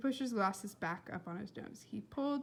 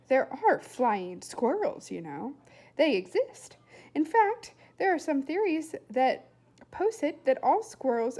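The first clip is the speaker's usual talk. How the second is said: put-on louder, higher earnest lecturing boy; know-it-all lecture; 5 semitones higher, 7 dB louder than usual. A narrator's reading. William explaining flying squirrels, louder and insistent. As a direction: lecture them with great conviction.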